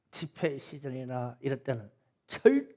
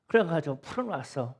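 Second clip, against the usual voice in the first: ordinary talk, nothing marked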